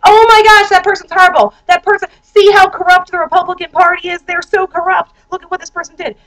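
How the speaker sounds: parroting indignant liberals, mocking; mock outraged Democrat. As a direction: ham up the shocked partisan gasp. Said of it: "the Democrats are all gonna be like".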